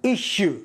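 "issue" set apart, with a clear "sh"; demonstrated pronunciation. Shown as a demonstration. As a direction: say "issue" as ISH-oo. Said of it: "كيف نطقت؟ ركز". (نطق "issue" بوضوح بصوت الشين)

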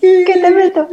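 high, loud hammed-up dinosaur taunt; laughing dinosaur taunt; mock tyrannosaurus. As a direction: squeal it like the little-armed T. rex. Repeating the T. rex line.

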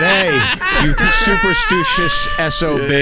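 reading a text through laughter; giggling through the quote; laughing while reading. Retold or imitated. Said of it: "He, uh, laugh".

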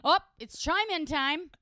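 high, loud sarcastic singsong; mocking. Real, not acted. Co-host mocks the made-up segment name, loud and high. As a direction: spit out the phrase with sarcasm.

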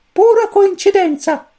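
very high, slow, sententious squeak; pronouncing chick. A narrator's reading. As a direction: declare it like a tiny judge. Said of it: "sentenziò il Cosmo Pulcino". (pulcino che sentenzia, lento e acutissimo)

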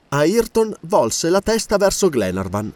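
ordinary delivery

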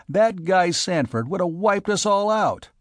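high, uneasy anger; angry. A narrator's reading. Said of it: "the chief said angrily".

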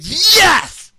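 high, loud triumphant yell; thrilled yes. Retold or imitated. Narrator cheering at the girl's invitation, high loud yell. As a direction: cheer like you just won.

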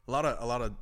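normal talking voice

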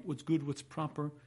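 usual speaking voice

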